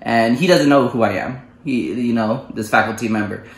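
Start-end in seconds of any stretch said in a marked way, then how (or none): none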